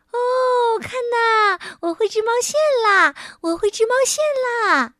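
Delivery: high, loud, squealing mouse; bursting with joy at a new skill; jubilant. Acted. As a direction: shout it out in triumph. Mouse triumphs at first knitting.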